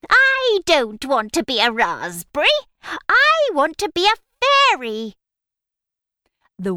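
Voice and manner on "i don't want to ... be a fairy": high, loud whiny witch. Acted. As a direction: whine like a frustrated witch. Witch complains about the result.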